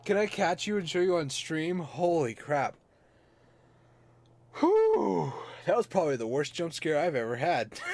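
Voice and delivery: high, loud after a jump scare; shaken, catching breath; startled. Real, not acted.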